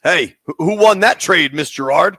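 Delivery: loud teasing call-out to the teacher; mock taunt.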